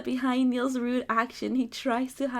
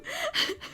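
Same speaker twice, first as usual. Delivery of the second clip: high giggle. Real, not acted. Flustered laugh.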